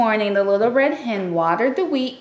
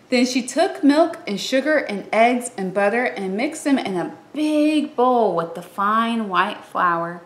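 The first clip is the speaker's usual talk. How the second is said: plain reading voice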